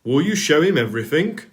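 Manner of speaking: loud, carrying voice; the Fat Controller calling to Edward about Percy. A narrator's reading. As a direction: keep calling across the yard. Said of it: "he called".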